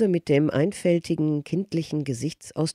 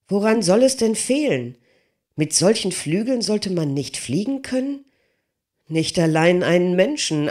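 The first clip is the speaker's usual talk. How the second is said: higher, louder, insistent begging of a simple giant; pleading smith; 5 semitones higher, 4 dB louder than usual. Acted. Smith begging Leonardo to let him test the wings, higher and louder. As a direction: plead hard to be allowed to fly.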